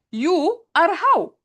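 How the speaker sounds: "You are how." slow and loud, falling on "how"; slow, stressed literal reading. Shown as a demonstration. Teacher stressing the literal "You are how." for students. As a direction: spell out "You are how." word by word, slowly. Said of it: "actually, they are correct".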